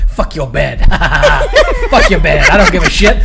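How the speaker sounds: brash, laughing sketch voice. Acted. Burglar treading on the director's bed, laughing defiance. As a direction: build to loud, cackling defiance. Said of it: "feel that's what he did".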